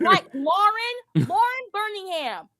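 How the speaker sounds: drawn-out sassy naming. Real, not acted. Calling her real name.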